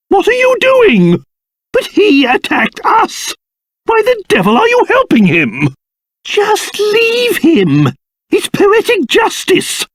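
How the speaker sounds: high-pitched indignant objection; exasperated protest. Acted. King objecting to helping the man who attacked them, indignant. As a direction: protest in outraged disbelief.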